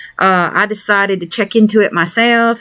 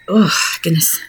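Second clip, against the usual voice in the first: whispered; 3 semitones lower than usual. Real, not acted. Exhausted aside, sigh follows.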